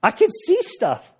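high, jittery voice, refusing to go in. Retold or imitated. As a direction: play a nervous disciple balking at the dark tomb. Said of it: "[name]'s kinda like".

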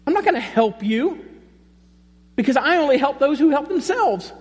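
high, mocking, dismissive voice; sarcastic, impatient mock Jesus with a laugh; mock grumpy Jesus. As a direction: brush him off with a laugh in the voice. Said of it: "He didn't do this".

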